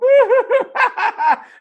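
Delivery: very high, loud cackling burst; exaggerated high-pitched laughter; mock hysterical laugh. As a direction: act out a client cracking up. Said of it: "I'm gonna have you laughing".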